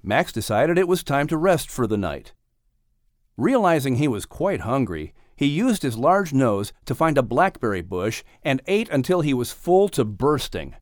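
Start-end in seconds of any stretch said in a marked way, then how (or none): none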